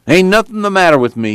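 put-on high-pitched protesting old man; stubborn Grandpa. A narrator's reading.

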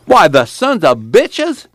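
slow, high-pitched indignant cry; acted slow-burning outrage; outraged. A narrator's reading. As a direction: draw it out in rising outrage. Pa outraged at the wage cut.

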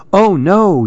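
high, alarmed little yelp; a startled squeak of fear. A narrator's reading. Susan realizing she is the soup, alarmed yelp. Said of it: "yelped Susan".

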